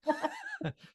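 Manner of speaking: high burst of laughter; bright amused laugh. Real, not acted. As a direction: laugh at the fancy word. Laughing at the big words.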